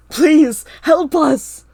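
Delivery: very loud, high pleading scream. A narrator's reading. Narrator screams for help in the fog, loud scream. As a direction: scream as loud as you can. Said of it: "I screamed as loud as I could".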